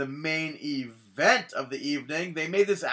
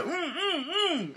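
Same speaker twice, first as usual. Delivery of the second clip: high hummed mm. Retold or imitated. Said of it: "I was about to add".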